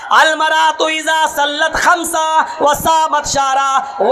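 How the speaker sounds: full-force pulpit preaching with chanted Arabic; fiery preaching. Acted. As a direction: thunder the sermon at the congregation.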